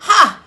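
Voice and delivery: loud scoffing ha; scornful laugh. Acted. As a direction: bark one loud mocking ha. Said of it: "said Pharaoh".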